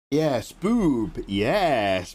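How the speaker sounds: usual speaking voice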